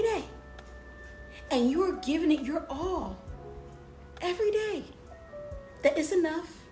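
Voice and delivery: rising, building encouragement; motivational pep talk. Acted.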